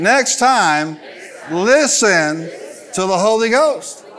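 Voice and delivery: punchy, high-energy pulpit delivery; full-force preaching. Real, not acted. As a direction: drive every phrase home to the congregation.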